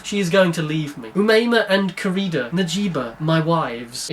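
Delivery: usual speaking voice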